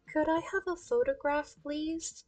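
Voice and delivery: very soft, timid little fan voice; shy kid. Acted. Kid asks cosplayer for a photo, shy kid. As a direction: ask meekly, almost under your breath.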